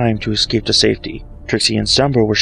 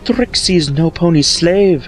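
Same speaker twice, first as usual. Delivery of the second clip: proud retort; 7 semitones higher, slower than usual. Acted.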